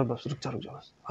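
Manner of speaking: very low, barely voiced plea to stop; overwhelmed hush. Umar overcome by the verses asking his sister to stop, hushed. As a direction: drop to a faint, overcome murmur.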